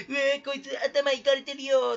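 a lightly voiced, grossed-out viewer, slow; slow, mock-disgusted sneer. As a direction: play a put-off viewer sneering at the video. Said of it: "みたいな感じで".

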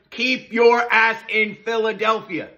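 loud, slow angry demand. Acted. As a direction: bark it out slowly. Ranting demand.

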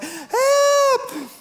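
high squeaky cry for help; mock baby scream. Preacher imitating a lost baby in a store, high squeaky cry. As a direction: imitate a lost child crying out. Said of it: "a baby's scream in a store".

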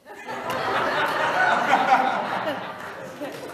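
long, loud, high laughter. Real, not acted. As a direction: crack up laughing. Laughs at "what's Facebook.

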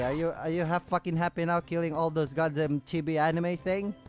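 ordinary talk, nothing marked

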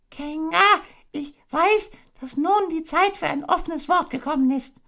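very high squeaky voice, trying to sound brave; a small pig mustering courage; brave little Piglet. Acted. Piglet telling Kanga the truth, bravely. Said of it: "mit so tapferer Stimme wie möglich".

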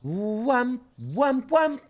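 smack it out like an impact; mock wham effect. Streamer slamming items down, high mock sound.

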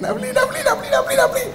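very high-pitched, rapid repeated whine; mock whining. Preacher acts out desire pestering to buy, high mock whining. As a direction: beg like a pestering child. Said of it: "Dia merengek".

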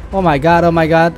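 usual speaking voice

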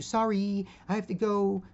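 high, loud apologetic woman's voice; mock timid brush-off up high; meek lady. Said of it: "As opposed to".

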